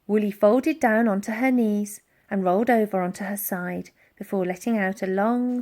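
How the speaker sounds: ordinary delivery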